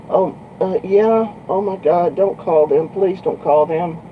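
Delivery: high-pitched mock pleading; imitated pleading girl. Girl begging trucker not to call her parents, imitated pleading.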